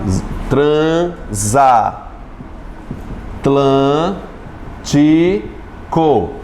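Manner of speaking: "transatlântico" very slowly, tran, za, tlan, ti, co; separado em sílabas. Shown as a demonstration. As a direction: draw out each syllable of "transatlântico" with long pauses between. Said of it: "como é que eu separo essa palavra". ("transatlântico" bem devagar, sílaba por sílaba)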